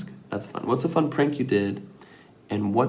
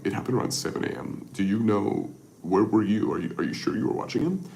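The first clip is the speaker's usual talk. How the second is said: disguised neighbor voice. Retold or imitated. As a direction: fake a stranger on the phone. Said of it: "and put him on a voice".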